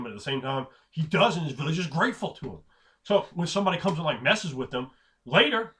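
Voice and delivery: ordinary talk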